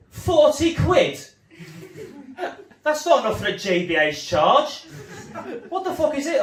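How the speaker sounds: high, loud furious yell; teen robber yelling at his victim over forty quid; outraged mugger. Retold or imitated. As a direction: explode at the pathetic amount. Robber outraged at small withdrawal.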